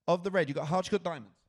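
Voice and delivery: loud, rapid-fire choices. Real, not acted. Host pressing a volunteer to pick a suit, rapid-fire. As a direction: fire the options out loudly, no hesitation. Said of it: "Even quicker".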